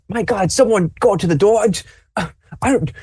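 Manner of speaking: high, flustered voice; a pet in a panic pushing someone else to act; flustered Fancy Paws. Acted. Fancy Paws begging someone to answer the door, high and fluttery. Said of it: "Fancy Paws is just like".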